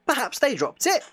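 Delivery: a hint of a small sidekick; Tails voice. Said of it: "says Tails".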